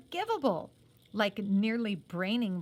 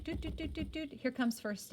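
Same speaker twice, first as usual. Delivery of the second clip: mock siren doot doot, higher and softer; siren noise; 4 semitones higher, 6 dB quieter than usual. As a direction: imitate an ambulance siren. Siren noise for first aid.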